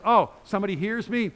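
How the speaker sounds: high, loud burst of grateful wonder; acted loud amazement; amazed exclamation. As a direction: exclaim it as if astonished. Acts out grateful amazement at being heard.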